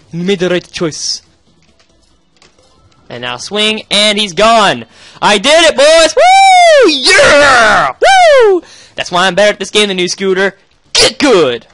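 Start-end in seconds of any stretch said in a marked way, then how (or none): 5.2-8.6 victory shout. Real, not acted. Victory celebration.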